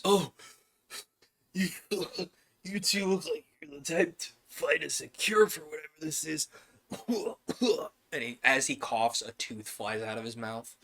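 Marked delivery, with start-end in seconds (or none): 0.0-6.5 lightly voiced sick shopkeeper, higher; NPC voice. Sick NPC brightens at party. 6.9-7.9 high wheezy cackle; a sick old man's cackle; wheezy laugh. Acted. NPC's sickly laugh.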